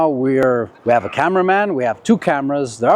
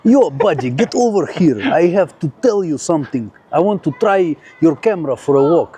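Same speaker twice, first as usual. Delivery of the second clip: English with a put-on Russian accent. Shown as a demonstration. Russian passer-by confronting the cameraman on request, Russian accent. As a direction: play a Russian stranger, thick accent in English. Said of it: "say it the way a Russian would".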